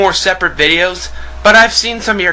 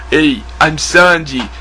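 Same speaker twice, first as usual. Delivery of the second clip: put-on Sanji with Brooklyn accent; 3 semitones lower than usual. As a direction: do a cheesy Brooklyn tough guy who's smoked for years. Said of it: "I have a Brooklyn accent".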